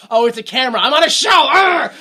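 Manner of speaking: loud, high angry realisation ending in a roar; annoyed victim. Retold or imitated. Pranked stranger spotting the camera, loud angry outburst. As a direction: blow up at being pranked. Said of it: "And they go".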